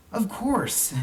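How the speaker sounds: put-on high, eager young boy; lightly voiced child, keen to explain; a boy's bright voice. A narrator's reading. Boy answers uncle.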